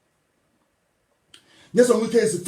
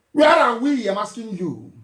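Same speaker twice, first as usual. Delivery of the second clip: ordinary delivery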